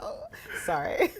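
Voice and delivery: high, laughing little apology; mock apology. Guest recalling her reply to Northern family, laughing mock apology. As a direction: toss off a pretend sorry through laughter. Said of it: "I'm like".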